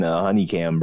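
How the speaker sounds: ordinary talk, nothing marked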